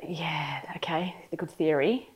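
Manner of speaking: whispered aside, barely voiced; host reacting skeptically to the story, whispered; under-breath whisper. Real, not acted. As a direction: murmur it to yourself, unconvinced.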